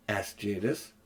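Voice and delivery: ordinary delivery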